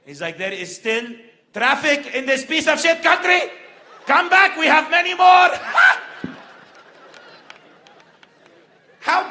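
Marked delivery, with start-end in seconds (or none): 0.4-5.5 put-on loud, high Lebanese accent. Old Lebanese man yells toward the highway, loud Lebanese accent. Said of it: "this old Lebanese guy". 5.6-6.8 loud, breathy burst of laughter; loud mock laugh. Old man laughs at his own taunt, loud laugh.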